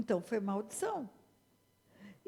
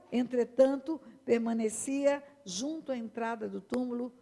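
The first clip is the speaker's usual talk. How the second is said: ordinary delivery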